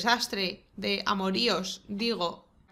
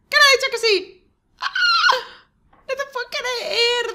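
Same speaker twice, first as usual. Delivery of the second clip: high, loud, overjoyed cries; thrilled squealing; 14 semitones higher, 8 dB louder than usual. Real, not acted. Gamer squealing as the Sims become a couple. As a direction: squeal it out in disbelief.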